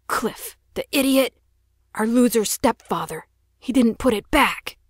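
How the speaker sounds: high, loud furious rant; boy raging at the missing rifle; angry outburst. Acted. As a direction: blow up at the stepfather. Furious at stepfather.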